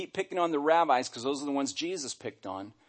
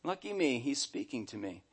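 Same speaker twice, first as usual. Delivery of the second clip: mock the meek woman's awe, trailing off; soft, small imitation of a meek woman; mousy, meek; 8 dB quieter than usual. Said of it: "She wasn't being this mousy, meek kind of".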